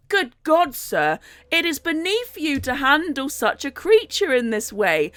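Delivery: shocked, high-starting protest; appalled priest. Acted. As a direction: exclaim it like a scandalised clergyman. Acts a priest appalled at cruelty.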